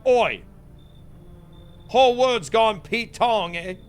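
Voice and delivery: mock British. Player riffing on the NPC in a mock British accent. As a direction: ham up a London geezer.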